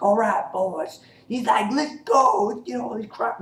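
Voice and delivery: lightly voiced gruff boss, pitched high; Negan voiced high. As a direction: call the boys over in a high put-on voice. Retelling Negan rounding up his men.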